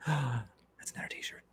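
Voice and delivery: slow excited whisper. Real, not acted. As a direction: breathe it out like a eureka.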